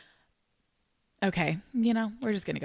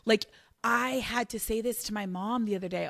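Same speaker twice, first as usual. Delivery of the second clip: usual speaking voice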